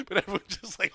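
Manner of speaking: normal talking voice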